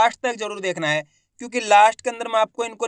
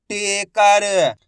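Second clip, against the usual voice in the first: Taker drawn out very slowly; teacher blending taker slowly for pupils; slowly blended word; 4 dB louder, much slower than usual. Shown as a demonstration. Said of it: "मैं किस तरीके से एक एक अक्षर का उच्चारण करके".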